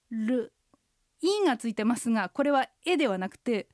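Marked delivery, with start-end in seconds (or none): 0.1-0.5 "ル" with the tongue curled back; retroflex r. Shown as a demonstration. Teacher explains the r sound, tongue-curled. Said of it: "Rが舌を巻いて".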